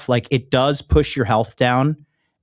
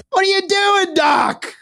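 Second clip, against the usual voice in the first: much higher hammed-up yell at the doc; mock shout; slower than usual. Yelling at the surgeon on screen, mock outraged shout. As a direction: shriek at the doctor in fake outrage.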